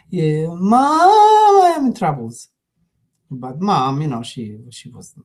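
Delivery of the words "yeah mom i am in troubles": high, put-on cry of a child pretending trouble; child's fake call for mom. Child pretending trouble calls mom, high fake cry. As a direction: call out like a kid faking distress. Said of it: "So he said".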